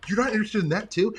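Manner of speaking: mildly baffled, retold; retold disbelief. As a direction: imitate your own puzzled disbelief. Retold surprised question.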